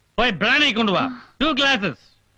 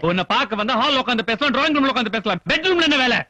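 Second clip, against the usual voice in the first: raised pitch climbing to an indignant question; heated parental rebuke; angry scolding; 4 semitones higher than usual. Acted. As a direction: berate a daughter, building. Father scolds daughter over male visitor.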